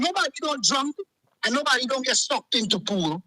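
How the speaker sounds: ordinary talk, nothing marked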